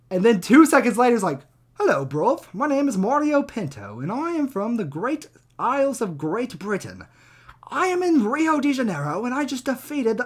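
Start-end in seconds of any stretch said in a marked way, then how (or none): 1.7-10.2 high-pitched mock British accent; Mario Pinto. Fighter introduces himself to the interviewer, hammed-up British accent. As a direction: put on an exaggerated, singsong British accent. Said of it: "perfect fucking English in the Lerone Murphy accent".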